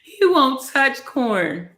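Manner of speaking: high, slow words through laughter; giggling in disbelief; laughing. Real, not acted. Laughing at baby eating corn.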